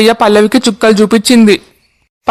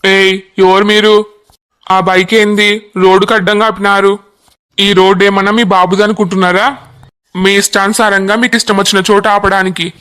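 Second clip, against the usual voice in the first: a constable scolding youths for blocking the road; lightly voiced cop laying down the law; stern police constable. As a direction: tell them off with police authority.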